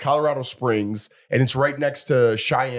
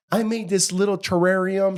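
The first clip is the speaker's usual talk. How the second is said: put-on high-pitched, sweet child voice; sweet little girl; 8 semitones higher than usual. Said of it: "her voice was, like, so sweet".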